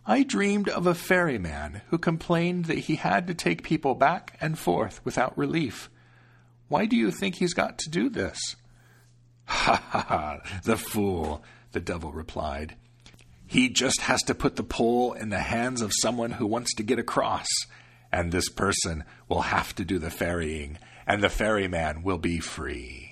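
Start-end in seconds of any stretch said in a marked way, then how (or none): 0.0-8.6 protective grandmother, unhurried; old grandmother. Acted. Grandmother recounts dream. 9.5-11.4 low scornful laughter; mocking devil laugh. Devil mocks ferryman. 13.5-23.1 smug devil. Acted. Devil explains.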